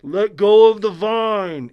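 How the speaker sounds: high, loud, slow voice from the heavens; slow heavenly boom; booming voice from above. Retold or imitated. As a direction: boom it down slowly like a voice from the sky. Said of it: "And the voice goes".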